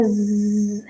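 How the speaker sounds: soft, sustained buzzing zzz; bee buzz. Shown as a demonstration. Teacher showing pupils the sound bees make, soft buzzing. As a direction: imitate a bee's low-volume buzz. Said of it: "वो एक sound निकालती हैं".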